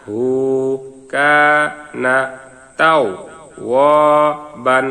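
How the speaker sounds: each syllable said alone with long gaps. Shown as a demonstration. Teacher to students, spelling out the verse syllable by syllable. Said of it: "ভাঙা ভাঙা উচ্চারণ".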